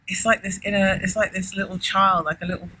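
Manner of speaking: ordinary talk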